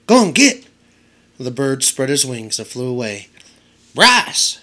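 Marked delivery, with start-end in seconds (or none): 0.1-0.6 high, loud shout to scare a crow. A narrator's reading. Boy shouting at a crow on Edward's head, loud and high. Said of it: "flapped his arms and shouted". 3.9-4.6 high, loud call of a name; shout. A narrator's reading. Old woman shouting at Bryce to leave the rabbit, high and loud. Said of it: "shouted the old woman".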